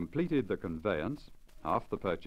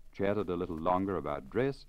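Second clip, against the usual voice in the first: ordinary delivery, nothing marked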